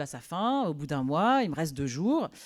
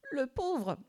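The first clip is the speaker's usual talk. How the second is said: ordinary talk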